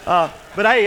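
usual speaking voice

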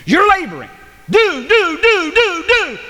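high, driving pulpit chant; hammering repetition. Acted. Preacher showing endless labor to the congregation, hammering repetition.

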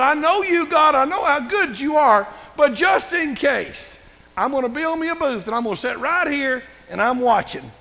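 high, loud taunting challenge; defiant Jonah. Retold or imitated. Jonah outside the city daring God to destroy it, high loud taunt. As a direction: dare God to bring it on.

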